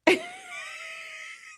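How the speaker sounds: a high, cracking scream of laughter; shrieking laugh. Real, not acted. Laughing hard at her friend getting popped, shrieking. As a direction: let the laugh break into a shriek. Big laugh at the slap story.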